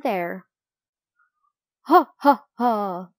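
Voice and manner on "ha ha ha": laughing gull cry. A narrator's reading. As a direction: imitate gulls crying with laughter. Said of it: "cried the laughing gulls".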